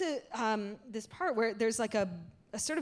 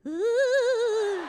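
high, loud ghostly hoot; long wavering "ooh" like a play ghost; mock spooky "ooh"; 12 semitones higher, 7 dB louder than usual. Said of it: "And she just goes".

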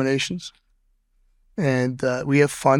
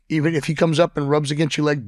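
normal talking voice

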